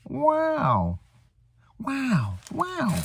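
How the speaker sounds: high, soft, stretched wows; Owen Wilson wow. Retold or imitated. Impression of Owen Wilson's signature wow, high and soft. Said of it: "That's my Owen Wilson".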